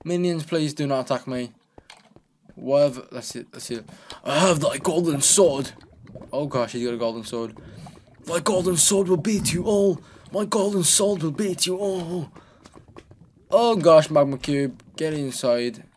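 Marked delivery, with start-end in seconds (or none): 4.2-12.3 mock heroic warrior. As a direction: proclaim like a hammy knight. Mock heroic boast in game.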